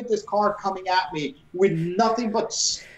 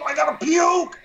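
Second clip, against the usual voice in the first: urgent yell; 10 semitones higher than usual. Retold or imitated. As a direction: blurt it out, about to be sick. Drunk guy about to vomit.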